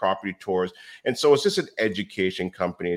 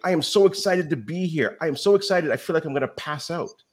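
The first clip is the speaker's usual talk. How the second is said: much higher, gushing hammed-up excitement; mock excited; 9 semitones higher than usual. Retelling how he psyched himself up before going on stage, hammed-up excited. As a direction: play it over the top excited, almost giddy. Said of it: "by saying I'm excited".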